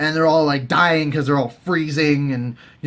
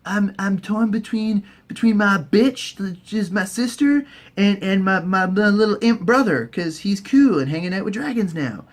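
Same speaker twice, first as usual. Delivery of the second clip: higher goofy brother moaning, slowed; mock Jaime whining; 6 semitones higher than usual. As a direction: whine it, torn and dopey. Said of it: "Jaime's all like".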